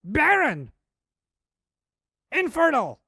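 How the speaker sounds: high, loud cries of shock; acted outburst of disbelief; screaming wife. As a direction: shriek each word separately, falling at the end. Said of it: "And she said".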